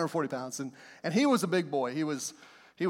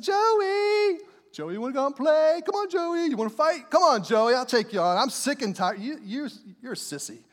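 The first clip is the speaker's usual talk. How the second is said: mocking taunt. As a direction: goad him like a schoolyard taunt. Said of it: "And so I started to mock him".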